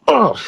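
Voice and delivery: short high "oh" of mock pain; imitated pained yelp. Storyteller as cop headbutted by goat, imitated yelp. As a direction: yelp as if your hand got hit.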